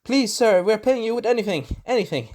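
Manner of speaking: high, fast, hammed-up begging; pleading villagers. Villagers beg the Mandalorian for help, high mock pleading. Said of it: "they're like".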